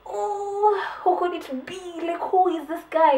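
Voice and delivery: high, curious guessing; mock puzzled wondering. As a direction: wonder aloud who the killer is, lightly. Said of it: "had me feeling like".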